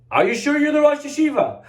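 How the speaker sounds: put-on a worried boy double-checking; nervous check. Boy nervously asking the voice behind the door.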